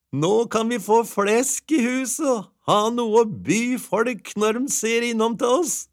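wife praising husband's trade; cheerful wife. A narrator's reading. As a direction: gush like a pleased wife. Wife's happy voice.

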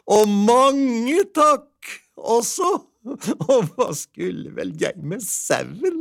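put-on very high, excited old-tale housewife with little laughs; delighted wife. A narrator's reading. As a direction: gush in a high voice, chuckling between thoughts.

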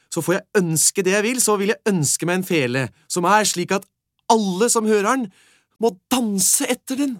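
put-on boy telling the beggar his wish, high and loud. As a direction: wish eagerly like a lad dreaming aloud.

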